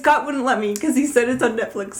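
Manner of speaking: laughing complaint; amused, laughing at her husband's excuse. Real, not acted. Laughing while telling friend about Scott.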